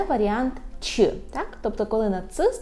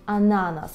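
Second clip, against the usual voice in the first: ананас as a-NA-nas, falling at the end; penultimate stress. Shown as a demonstration. Teacher confirms where stress falls, ананас stressed a-NA-nas. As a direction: stress the middle syllable of ананас. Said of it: "наголос повинен бути саме такий".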